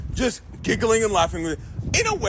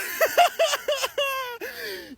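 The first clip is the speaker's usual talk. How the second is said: long, high burst of laughter; real laugh. Real, not acted. Storyteller laughing high as the fighters close in. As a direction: break up laughing mid-quote.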